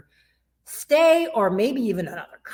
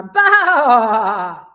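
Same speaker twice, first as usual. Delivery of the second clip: lower, slow sheep "ba"; sheep bleat; 8 dB louder than usual. Shown as a demonstration. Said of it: "but a little lower".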